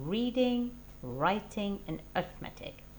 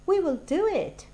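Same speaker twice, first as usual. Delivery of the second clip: higher, louder triumphant cry; excited; 10 semitones higher, 10 dB louder than usual. Acted. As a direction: burst out with the decision. Scheme decided with excitement.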